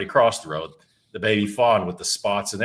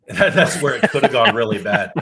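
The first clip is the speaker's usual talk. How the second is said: louder, laughing through the words; 3 semitones higher, 8 dB louder than usual. Real, not acted. The teller laughing as he recalls the near fight. Laughs at his own story.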